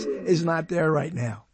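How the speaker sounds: usual speaking voice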